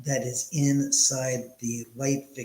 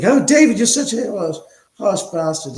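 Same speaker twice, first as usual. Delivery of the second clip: high, loud mock outrage; hammed-up loud reproach, easing off toward the end; mock scolding; 8 semitones higher, 9 dB louder than usual. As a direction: imitate an exclaimed telling-off, pitched way up. Imitates someone scolding him.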